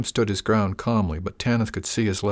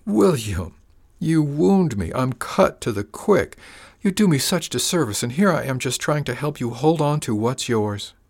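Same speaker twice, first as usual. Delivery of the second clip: mock wounded; 6 semitones higher, 4 dB louder than usual. Villain feigns being wounded.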